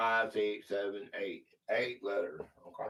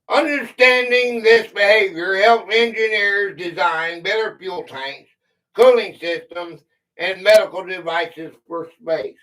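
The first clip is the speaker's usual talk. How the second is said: reading a quiz clue; 7 dB louder than usual. Acted. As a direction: clear read-aloud quiz voice. Reads a quiz clue aloud.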